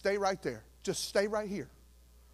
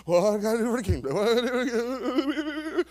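mock fretting; 3 semitones higher than usual. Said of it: "I'm not working up every Monday going".

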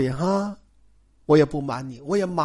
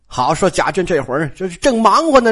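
ordinary delivery, nothing marked

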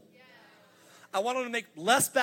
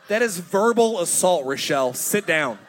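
louder, firm mock scolding; 2 semitones lower, 8 dB louder than usual. Husband scolding wife at kids' game, firm mock scolding. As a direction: scold her to sit down.

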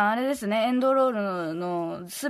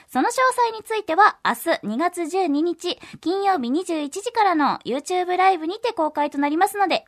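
upbeat announcer with lifted phrases; polished promotional announcement; radio promo read; 5 semitones higher, 4 dB louder than usual. Acted. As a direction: pitch the news to listeners clearly. Reads game information.

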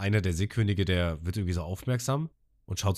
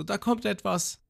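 high, ominous character voice; Abi imitated; 11 semitones higher, slower than usual. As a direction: warn them with a raised voice. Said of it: "Und Abi so". (hohe, warnende Figurenstimme)

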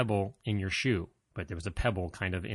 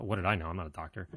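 ordinary talk, nothing marked

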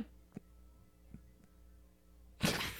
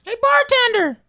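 calling out; 4 semitones higher, 7 dB louder than usual. Acted. Calling out to bartender.

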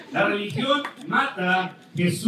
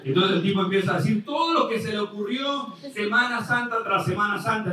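ordinary talk

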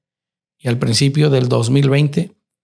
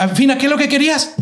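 rude impatient tone; 12 semitones higher, much faster than usual. Retold or imitated. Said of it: "era de un grosero hombre que no tiene respeto".

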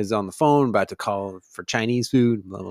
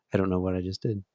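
ordinary talk, nothing marked

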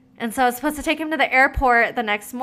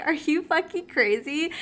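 higher, incredulous retort; 7 semitones higher than usual. Retold or imitated. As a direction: toss it back in shocked disbelief. Retelling her shocked reply to friend.

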